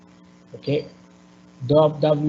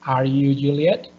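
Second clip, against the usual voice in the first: "Are you Juliet?" climbing steeply on "Juliet"; yes-no question, upward glide on "Juliet"; rising intonation. Shown as a demonstration. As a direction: lift the end of "Juliet" into a question. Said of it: "is rising".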